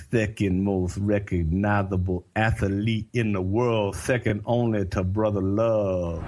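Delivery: slow, drawn-out lisping boast; mocking imitation. Breaking the silence in the car to needle Dusty, mocking imitation. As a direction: parody your friend's boast, stretching every word.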